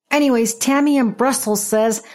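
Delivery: normal talking voice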